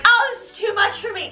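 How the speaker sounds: high-pitched, hammed-up swooning; overwhelmed swoon. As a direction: swoon as if about to faint. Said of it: "she's just like".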